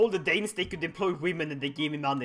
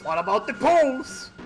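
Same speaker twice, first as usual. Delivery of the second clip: ordinary delivery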